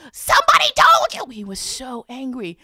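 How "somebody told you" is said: very high, loud enraged shriek; furious shriek. Acted. The imp shrieks in rage that someone told. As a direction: scream it in outrage.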